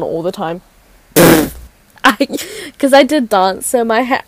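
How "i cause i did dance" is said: ordinary talk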